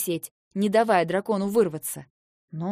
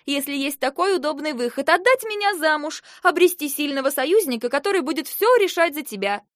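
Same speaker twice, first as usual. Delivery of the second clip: high, raised, heated voice; sarcastic accusation; 8 semitones higher, 5 dB louder than usual. Acted. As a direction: press the accusation with rising indignation. Sister presses her sarcastic accusation. (язвительно, на повышенных тонах)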